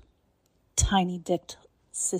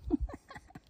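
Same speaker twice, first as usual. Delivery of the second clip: mocking laugh; 7 dB quieter than usual. Domme mocking her sub.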